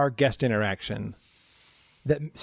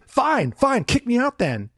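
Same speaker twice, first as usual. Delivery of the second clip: much higher, petulant mock whine; defiant guest; 8 semitones higher, slower than usual. Guest daring security to eject her, high petulant whine. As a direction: toss it off like a sulking child.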